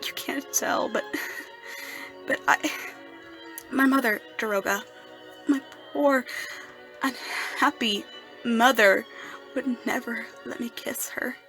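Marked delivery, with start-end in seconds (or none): 2.3-9.0 loud, halting lament; anguished grief. Acted. Son remembering his poor unhappy mother, anguished and halting. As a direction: force it out in swelling anguish.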